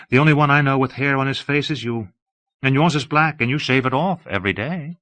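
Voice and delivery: a light, amused woman's voice; Delilah, lightly teasing. Acted. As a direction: tease him gently as Delilah.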